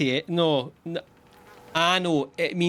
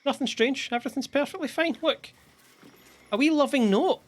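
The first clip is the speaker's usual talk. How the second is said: much higher, faked breezy reassurance; forced cheer; 9 semitones higher than usual. Player insisting to the party that nothing is wrong, much higher. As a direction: pretend all is fine, pitched way up.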